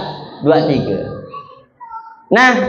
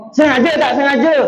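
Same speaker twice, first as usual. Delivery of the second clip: high questioning Sengaja tak sengaja; stern teacher; 6 semitones higher than usual. Retold or imitated. Kiai Khalil testing his student, stern question. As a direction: demand an answer from the pupil.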